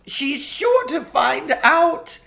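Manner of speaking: a faun crying as he lists his punishments; tearful faun. A narrator's reading. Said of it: "beginning to cry again".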